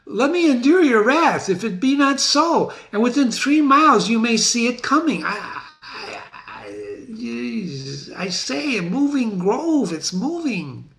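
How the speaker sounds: high and pleading, then breathless awe; a terrified servant insisting it's true; panicked messenger. Acted. Teacher voicing panicked messenger.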